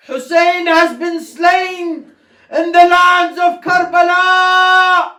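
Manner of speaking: very high, slow wailing cry; wailing lament. Acted. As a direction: wail each name, drawn out. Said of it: "Sayyida Zainab says".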